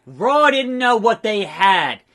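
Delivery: loud, slow, drawn-out declaration; indignant promo. Acted. Released wrestler cutting promo, indignant. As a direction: proclaim it slowly with wounded pride.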